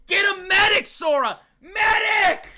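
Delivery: high frantic shout; calling for a medic. Real, not acted. Streamer panicking over the body, frantic high shout. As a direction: yell for help, voice going up.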